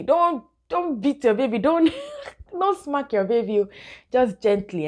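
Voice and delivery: high, amused, emphatic warning; high and loud, laughing through it; playful warning. Real, not acted. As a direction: laugh while warning not to hit.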